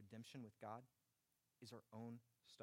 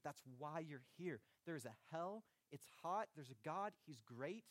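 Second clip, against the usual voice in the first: rising, louder preaching, slowing down at the peak; higher and louder, then slow and pleading at the end; preaching at full force; 4 semitones higher, 3 dB louder than usual. Acted. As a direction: build the altar call to a fervent climax. Closing altar-call climax.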